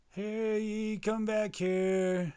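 high, stretched-out call; long, high drawn-out holler; calling after someone. Acted. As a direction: call out to someone getting away.

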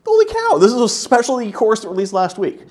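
sudden high, loud burst of amazement; amazed exclamation. Retold or imitated. Speaker amazed at course's popularity, excited high exclamation. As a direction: blurt it out like you can't believe the number.

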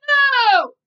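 piercing high, loud shriek. A narrator's reading. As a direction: shriek it out in refusal. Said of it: "shrieked Melinda".

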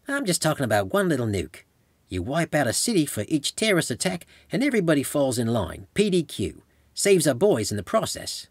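high-pitched tipsy cackler's voice; cackling woman. A narrator's reading. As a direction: hold forth like a cackling drinker. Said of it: "threw back her drink and cackled".

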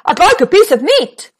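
loud, high shout. Acted. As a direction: shout it so she hears. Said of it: "Mister Noisy shouted".

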